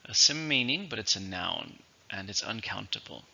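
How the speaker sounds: ordinary talk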